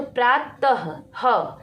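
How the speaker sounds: प्रातः said with a clear breathy h at the end; teacher sounds out प्रातः ending in an audible ह; visarga h sound. Shown as a demonstration. Hindi शिक्षिका बच्चों को विसर्ग का ह सुनाती हुई, प्रातः. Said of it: "ह की ध्वनि आपने सुनी".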